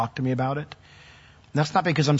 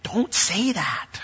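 mock scolding aside; 7 semitones higher, 4 dB quieter, much slower than usual. Reacting to wife's look.

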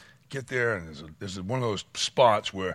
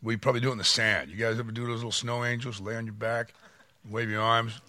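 ordinary talk

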